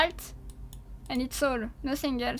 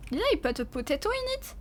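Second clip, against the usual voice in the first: ordinary delivery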